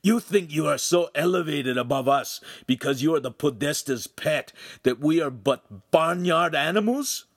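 high-pitched indignant tirade; angry, offended outburst; spitting rage. A narrator's reading. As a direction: accuse with mounting outrage. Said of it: "each time he spit out a phrase".

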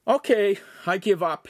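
put-on high-pitched teen giving up; Lincoln, surrendering teen. A narrator's reading. Lincoln after the dunking, giving up in a high voice.

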